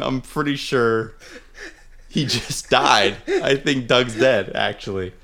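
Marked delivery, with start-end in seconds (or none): none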